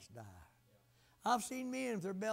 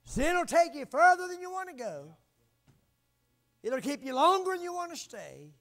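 high, loud pulpit holler; full-force preaching; 11 semitones higher, 15 dB louder than usual. Real, not acted. Preacher warns congregation what sin will do, shouting. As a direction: ring out each warning at full volume.

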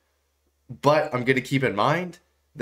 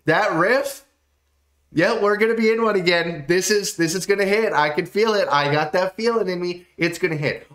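excited hype; 6 semitones higher, 4 dB louder than usual. Real, not acted. Hyped reacting to new song.

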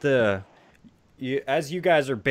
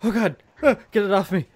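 high, gasping panic; panicked victim, loud at first, gasping between words; freaking out; 10 semitones higher, 6 dB louder than usual. Acted. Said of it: "he kinda freaks out".

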